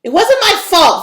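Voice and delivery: high, loud squeaky protest; high squeaky denial; squeaked. A narrator's reading. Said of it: "Vera squeaked".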